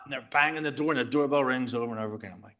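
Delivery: ordinary delivery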